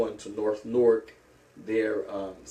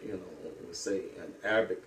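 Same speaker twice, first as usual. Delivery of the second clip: normal talking voice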